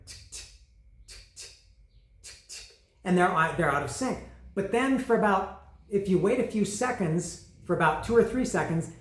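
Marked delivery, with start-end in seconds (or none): 0.1-2.8 soft, toneless ticking in spaced pairs; imitated turn-signal tick. Driver showing how the turn signal sounds, imitated ticking. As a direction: click like a car's blinker, evenly paced. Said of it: "your signal audibly is going".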